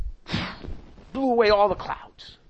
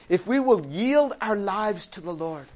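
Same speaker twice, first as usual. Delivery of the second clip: normal talking voice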